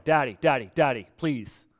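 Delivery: put-on low, earnest child's plea to a father; intimate pleading. Jesus cries out to Dad in the garden, intimate pleading. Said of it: "he is crying out to Dad".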